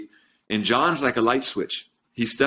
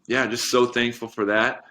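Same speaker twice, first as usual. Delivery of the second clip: usual speaking voice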